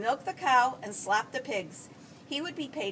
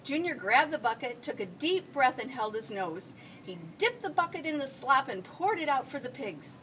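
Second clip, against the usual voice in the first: usual speaking voice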